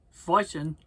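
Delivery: ordinary delivery, nothing marked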